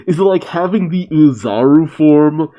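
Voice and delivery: ordinary talk, nothing marked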